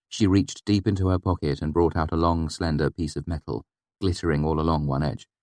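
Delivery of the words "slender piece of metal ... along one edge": usual speaking voice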